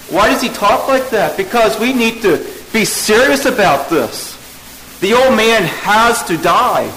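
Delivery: full-force preaching to a church; fervent preaching. Acted. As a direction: press hard on every phrase like a revival preacher.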